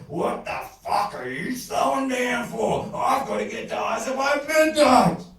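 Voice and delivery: high, very loud enraged bellow; furious roar. A narrator's reading. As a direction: roar it full out, building. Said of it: "when he roared".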